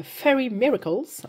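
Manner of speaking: Fairy Miracles in a slow, put-on English accent; playful mock English accent on a brand name. Shown as a demonstration. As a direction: say the title the English way, a bit hammed up. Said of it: "vous noterez l'accent anglais".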